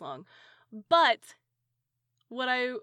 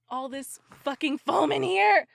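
louder, slow frustrated emphasis; raised, drawn-out exasperation; exasperated outburst; 4 semitones higher, 6 dB louder than usual. Real, not acted. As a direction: burst out in annoyance. Exasperated about wasted purchase.

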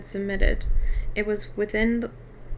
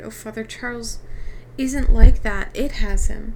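urgent cry, growing louder; voiced girl's outcry, punched louder midway; 7 dB louder than usual. A narrator's reading. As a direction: plead with father, building to a loud protest. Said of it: "Meg cried".

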